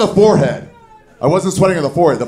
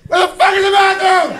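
much higher, frantic desperate yell; desperate panic. Pretend. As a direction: shriek it like you're about to lose it. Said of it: "And a ten is like".

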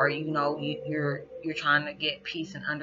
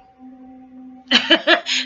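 a choked high laugh fighting back tears; loud, high tearful burst of laughter; 13 semitones higher, 12 dB louder than usual. Real, not acted. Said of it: "I'm trying not to cry".

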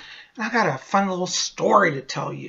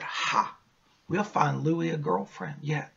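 sly whisper; 3 semitones lower, 7 dB quieter than usual. Acted. Sly aside to viewers.

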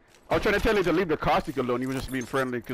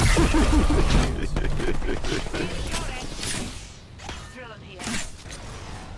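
high laugh; 12 semitones higher than usual. Real, not acted. Laughing at own play.

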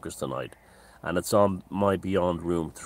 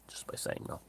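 whispered; 14 dB quieter than usual. Real, not acted. Emphatic point after question.

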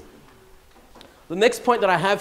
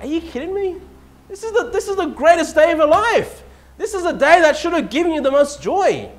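much higher, disbelieving exclamation; incredulous outburst; 11 semitones higher, 5 dB louder than usual. Retold or imitated. To a colleague shrugging off her anniversary, incredulous high outburst. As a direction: throw your hands up in disbelief.

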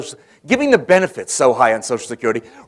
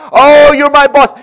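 higher and louder, gushing with delight; jubilant; 11 semitones higher, 13 dB louder than usual. Retold or imitated. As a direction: gush over the boss like an overjoyed senator. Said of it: "Long is jubilant".

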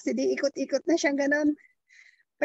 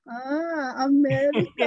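a knowing, drawn-out and louder "America"; imitating the taxi driver; 3 dB louder, slower than usual. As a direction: mimic the driver who has just worked out where they are from. (ginagaya ang drayber ng taxi, mas malakas at pahabang "America")

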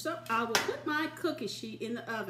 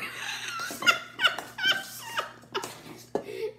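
high squealing laugh. Real, not acted. Laughing fit over the mistake.

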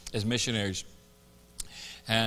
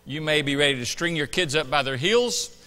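rising, louder pulpit exhortation; preaching; 6 semitones higher, 7 dB louder than usual. Acted. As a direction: build it up like a preacher. Preaches before communion.

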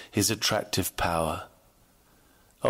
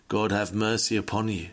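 normal talking voice

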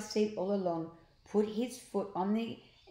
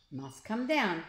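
ordinary delivery

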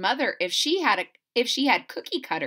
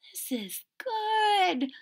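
much higher and slow praise; amazed boy; 11 semitones higher, 3 dB quieter, much slower than usual. Acted. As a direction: savour the taste. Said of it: "said Chester".

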